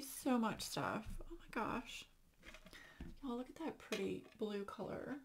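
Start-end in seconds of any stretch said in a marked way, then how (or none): 1.2-5.2 breathless whisper. Real, not acted. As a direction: barely breathe it out. Moved, thanking friend.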